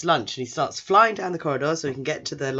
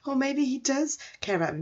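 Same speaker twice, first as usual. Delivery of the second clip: high, hopeful inner voice; hopeful musing; 11 semitones higher than usual. Retold or imitated. Confused boy hoping his teacher cares, high and hopeful. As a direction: let hope creep in, high. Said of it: "So he's like".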